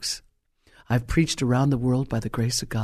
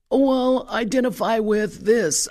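mocking voice; 8 semitones higher than usual. Mocking modern attitude.

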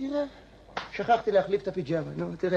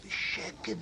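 whispered, hushed demonstration; quiet; 7 dB quieter, much slower than usual. Shown as a demonstration. Old man contrasting English tea-drinking with noisy slurpers, whispering. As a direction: whisper it to show refined restraint. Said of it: "האנגלים, ככה".

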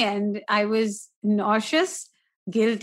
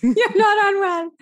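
excited; 10 semitones higher, 5 dB louder than usual. Real, not acted. Playful teasing agreement.

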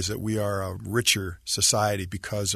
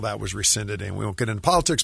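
normal talking voice

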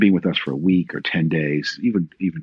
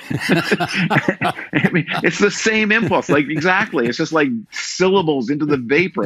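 laughing; 10 semitones higher, slower than usual. Real, not acted. Amused at daughter's quip about tweeting.

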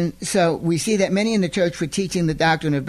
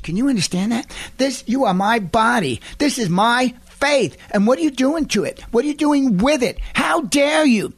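building fury; 7 semitones higher than usual. Retold or imitated. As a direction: start indignant, climb to a furious cry. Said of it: "He was furious".

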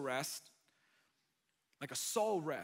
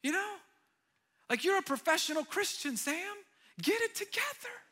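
higher, mock fed-up scolding; lightly voiced impatient Jesus looking down; exasperated Jesus; 13 semitones higher, 7 dB louder than usual. Pastor imitates an impatient Jesus scolding Sam, higher mock exasperation. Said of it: "he's like".

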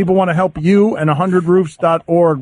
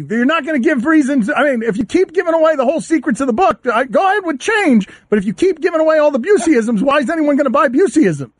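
raised, fast, exasperated voice; yelling; 7 semitones higher than usual. Real, not acted. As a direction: snap back at him, higher. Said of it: "I'm sorry I yelled at you".

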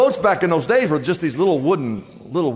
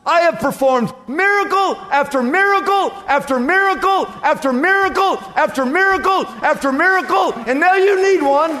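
high, raised voice, hammering each repeated phrase; exasperated rebuke; 3 dB louder than usual. Retold or imitated. Jesus scolding disciples who woke him from his nap, exasperated. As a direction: scold in loud, incredulous disbelief.

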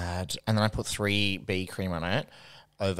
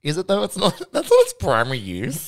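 laughing; 10 semitones higher, 8 dB louder than usual. Real, not acted. Laughing disbelief at friend.

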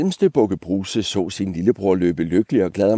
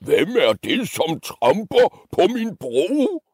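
high, slow, swooping troll shriek; screaming troll; 10 semitones higher than usual. Acted. Troll confronting the biggest goat, high drawn-out scream. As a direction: shriek the challenge, stretched out. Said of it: "skreg han".

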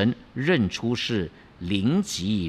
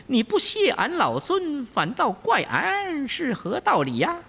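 high, wheedling monkey king pleading his case; high cheeky monkey voice protesting; indignant Wukong; 10 semitones higher than usual. Acted. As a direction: argue you saved him, all innocence. Wukong justifies himself.

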